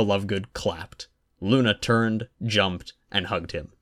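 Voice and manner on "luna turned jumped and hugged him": usual speaking voice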